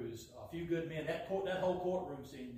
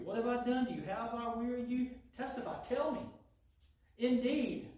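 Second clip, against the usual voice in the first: slightly sarcastic God; 5 semitones higher than usual. Acted. Almighty God mocking his people's complaints, sarcastic. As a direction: lay the sarcasm on lightly. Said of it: "this is slightly sarcastic God".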